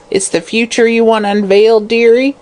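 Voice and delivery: high, wheedling crone; croaking fortune teller. Acted. Hag offering the visitor a reading, high croak. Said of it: "she croaked".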